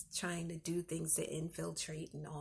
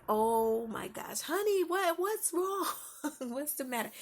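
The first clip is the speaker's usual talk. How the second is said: startled dad rushing in, mock panic; alarmed dad; 9 semitones higher, 9 dB louder than usual. Husband answering his wife's scream, loud mock alarm. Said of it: "And he's just like".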